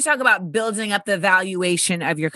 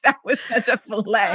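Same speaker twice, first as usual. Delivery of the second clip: laughing. Real, not acted. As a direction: laugh out the words slowly. Host still laughing.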